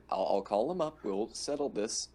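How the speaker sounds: ordinary talk, nothing marked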